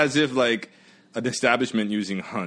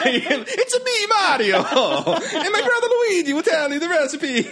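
high, loud Mario voice laughing; hammed-up Italian accent, laughing through it; bad Italian accent; 7 dB louder, slower than usual.

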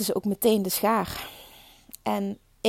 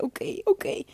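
retold giving in; 9 semitones higher than usual. Acts out her giving in to midwife.

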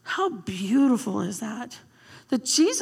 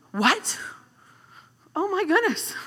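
amazed; 8 semitones higher than usual. Retold or imitated. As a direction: gasp it out in wonder. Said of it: "they're like".

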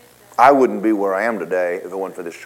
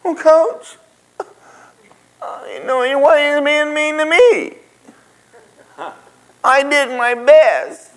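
put-on much higher, pleading little-boy whine; whiny player; 4 dB louder than usual. Player whines back to the coach, high pleading. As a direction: whine it like a hurt kid. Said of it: "And he goes".

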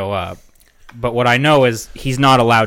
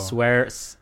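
ordinary talk

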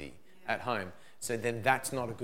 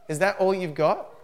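second take louder and higher, stress on "that"; "Is that all you've got?" thrown back louder, "that" pushed up high; louder taunt; 6 semitones higher, 7 dB louder than usual. Retold or imitated. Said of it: "they're like".

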